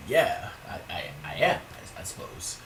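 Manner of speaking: imitating a hesitant, stammered reply; hesitant brother. Taken-aback brother answering a nosy stranger, hesitant stammer. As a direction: falter and trail off almost to a whisper, as the brother. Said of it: "my brother's kinda hesitant to respond".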